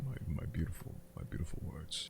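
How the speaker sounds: low, hushed muttering to oneself; soft musing. Pretend. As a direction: say it softly and low, to yourself. Trailing musing about own words.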